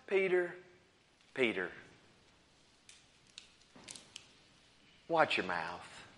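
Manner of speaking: gentle rebuke. Acted. Jesus gently rebukes Peter.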